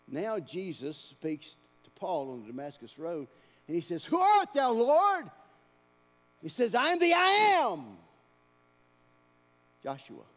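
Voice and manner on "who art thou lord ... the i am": loud, high, ringing proclamation; preaching at full force. Acted. Preacher proclaiming God's name to the church, at full force. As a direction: thunder the divine name out.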